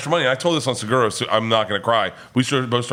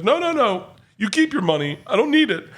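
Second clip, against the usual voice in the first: high-pitched imitation of a tearful man refusing; choked-up, retold pleading, much higher; mock sobbing protest; 11 semitones higher than usual. Crying man turning down the crowd's money, mock sobbing. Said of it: "They start sobbing".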